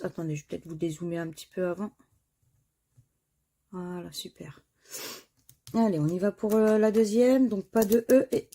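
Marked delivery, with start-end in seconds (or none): 3.7-4.6 whispered aside; soft whispered satisfaction. Real, not acted. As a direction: breathe it out under your breath. Quiet aside while adjusting zoom.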